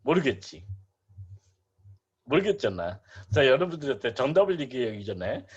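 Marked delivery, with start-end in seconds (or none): none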